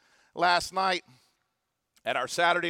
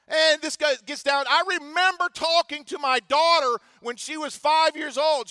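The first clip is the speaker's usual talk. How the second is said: high, loud preacher's delivery; impassioned preaching; 8 semitones higher, 4 dB louder than usual. Real, not acted. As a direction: preach it with raised pitch and force. Preacher rises to full force.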